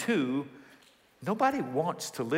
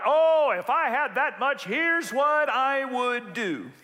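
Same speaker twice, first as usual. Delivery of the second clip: much higher, louder, drawn-out boast; mock grand dreaming; 10 semitones higher, 4 dB louder, slower than usual. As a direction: ham up the daydream of riches. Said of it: "the thought".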